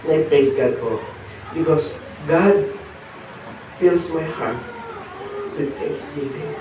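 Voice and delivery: soft and halting, with long pauses; hushed heartfelt testimony. Real, not acted. Preacher answers the Lord before the congregation, hushed and moved. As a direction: let the praise fade to very quiet.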